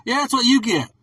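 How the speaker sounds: high, loud mocking gloat. Imagining himself gloating in hell, loud gloat. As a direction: crow over them from the flames. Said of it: "I'll be sitting there like".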